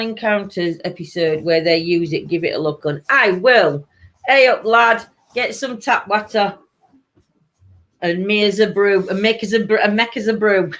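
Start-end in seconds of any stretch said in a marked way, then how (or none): 4.3-10.7 playful Yorkshire banter; mock Yorkshire dialect; Yorkshire accent. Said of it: "That's how I speak".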